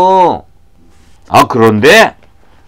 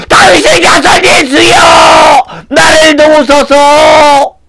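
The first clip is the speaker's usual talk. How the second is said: very high, wailing plea; louder desperate shouting; 12 semitones higher, 5 dB louder than usual. Acted. Blind man refusing to hush, desperate shouting. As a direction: cry out over the objections. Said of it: "더 크게 소리쳤어요".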